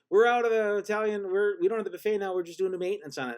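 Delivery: high, loud and hurried server voice; mock server. Server telling them the buffet is off, hurried mock voice. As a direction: rush the excuse like a flustered waiter. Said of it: "he, uh, said".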